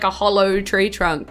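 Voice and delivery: ordinary delivery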